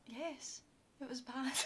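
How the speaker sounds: understated flat reply; flat quiet yes. Retold or imitated. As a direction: drop the voice, dry and certain. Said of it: "I'm like".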